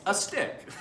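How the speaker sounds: "A stick" jumps up high, caught off guard; a startled laughing echo of "A stick"; surprised echo. Real, not acted. Dad surprised his kid knew the answer, high startled echo. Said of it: "Oh, you know that one".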